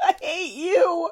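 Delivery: high, drawn-out mock whine; mock complaint. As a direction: playfully wail it after laughing. Comic reply to Tyra impression.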